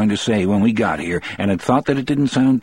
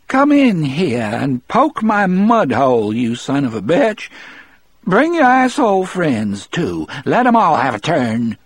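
high, querulous crude invitation; querulous old woman; 7 semitones higher than usual. A narrator's reading. Sick wife swears at the men from inside, querulous and high. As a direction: call out shrilly as a sick woman lost to herself. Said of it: "querulous and certain and utterly lost".

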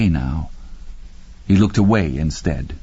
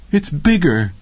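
moaning; 7 semitones higher than usual. Acted. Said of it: "Laverne moaned".

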